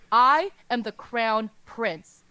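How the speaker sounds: "I" hammered, loud, slow and heavy; slow proclamation. Acted. Prince declares his rank, slow and loud. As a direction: drag out "I am the Crown Prince" as a loud, proud claim. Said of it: "screams in his loudest voice".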